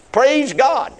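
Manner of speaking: ordinary delivery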